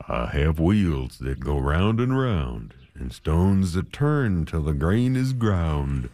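slow, drawn-out miller's reply; miller character. A narrator's reading. Miller answers the man's request, slow character voice. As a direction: stretch the words out in a slow, rolling rhythm.

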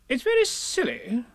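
high character voice; flustered Rabbit. A narrator's reading. Rabbit losing his way, high and put out.

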